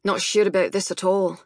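put-on gruff Scottish accent; doubtful, in a gruff Scots voice; Douglas Macmillan. A narrator's reading. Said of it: "Mac's gruff Scottish voice".

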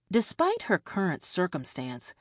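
ordinary delivery, nothing marked

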